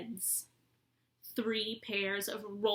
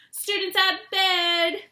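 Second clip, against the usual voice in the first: high, loud poltergeist bellow; 11 semitones higher, 10 dB louder than usual. A narrator's reading. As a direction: shout it down the corridor to raise the alarm. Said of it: "Peeves bellowed".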